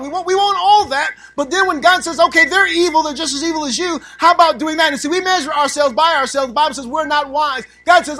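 loud, high preaching peak; preaching at full force. Acted. Preacher confronting the congregation at full force. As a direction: call the whole room out.

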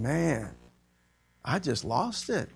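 ordinary talk

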